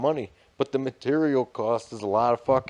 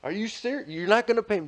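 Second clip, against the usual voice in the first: high-pitched mock indignation; indignant retelling; 10 semitones higher than usual. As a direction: act out a disbelieving, unpaid contractor. Retold unpaid contractor confronting client.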